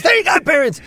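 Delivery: doing Mister Crocker to show his sitting style, high. Retold or imitated. High, manic Crocker voice. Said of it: "when I'm doing Mister Crocker".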